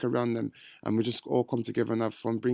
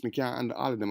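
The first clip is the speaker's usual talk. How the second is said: normal talking voice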